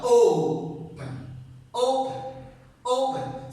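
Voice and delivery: "Open" with a high, strong O and light "pen"; stress on first syllable. Shown as a demonstration. Teacher drilling that first is stronger, "Open" stressed on the O. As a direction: punch the O in each "Open". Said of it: "First is stronger".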